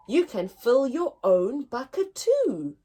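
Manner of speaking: ordinary delivery, nothing marked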